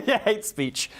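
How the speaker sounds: high, slow laugh through words; chuckling while saying it. Real, not acted. Laughing at the sarcastic joke.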